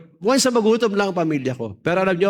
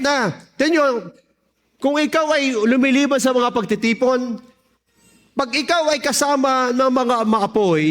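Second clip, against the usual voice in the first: mataas at madiing boses ng nangangaral; pangangaral; 8 semitones higher than usual. Real, not acted. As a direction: mangaral nang buong sigla sa kongregasyon. Preaches after the testimony. (mataas na tonong pampulpito)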